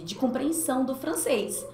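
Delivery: normal talking voice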